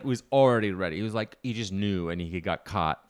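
usual speaking voice

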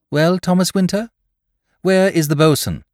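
put-on young woman voice. A narrator's reading. Polly addresses the crew.

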